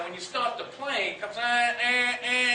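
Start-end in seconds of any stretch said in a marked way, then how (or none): 1.4-2.5 tight, pinched eh sounds, higher; constricted eh. Shown as a demonstration. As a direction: choke the throat on each eh. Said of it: "this real kind of constricted sound".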